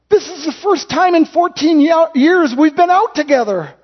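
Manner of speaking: high-pitched, acted tearful voice; mock sobbing wife. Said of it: "She's just sobbing".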